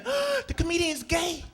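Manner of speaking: catty onlooker teasing; lightly voiced mocking crowd; mocking gossip. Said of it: "people will say".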